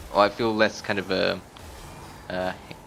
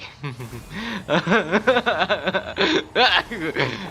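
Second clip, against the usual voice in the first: long, high peal of laughter; real extended fit of laughing; 6 semitones higher than usual. Real, not acted. Player doubts clearing the floor, laughing hard. Laughing at own poor chances.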